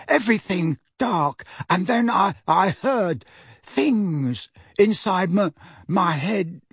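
put-on slow, high, halting fearful boy; hoarse, shuddering. A narrator's reading. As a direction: stammer it out, shuddering at the memory. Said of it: "said hoarsely, shuddering".

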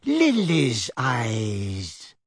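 very slow, a light hint of wheeze; an old wizard, breathing through the words; wheezy old wizard. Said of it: "said a wheezy-voiced".

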